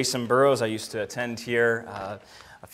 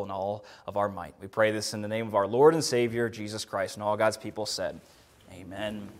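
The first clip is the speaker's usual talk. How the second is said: quiet, earnest prayer voice; 3 semitones lower, 5 dB quieter than usual. Acted. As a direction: pray it softly and sincerely. Opening prayer.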